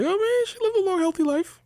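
high, falsely sweet justification; mock innocent. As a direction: sound innocent about it.

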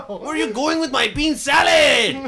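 high, shouted mock Mexican accent. Shown as a demonstration. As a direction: chase a thief in a cartoon Mexican accent. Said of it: "Do a Mexican accent".

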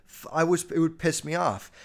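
ordinary delivery

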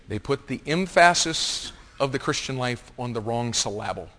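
"emphasis" said em-FASS-is, "syllable" said sil-LAB-le; misplaced stress. Shown as a demonstration. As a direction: jokingly shift the stress to the middle of "emphasis" and "syllable". Repeats the misstressing joke.

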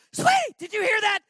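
high, loud thrilled call; new dad shouting to his wife about the baby's noise; excited call. Retold or imitated. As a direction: call out to the wife, bursting with delight.

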